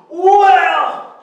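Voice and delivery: loud, drawn-out "well"; exclaimed well. Acted. Storyteller reacting to the boys' defiance, loud exclamation. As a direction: punch it out loud. Storyteller launches into king's tantrum.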